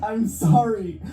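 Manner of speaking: high, anguished begging; desperate pleading. Acted. Boyfriend begs Lisa to come back, pleading in anguish.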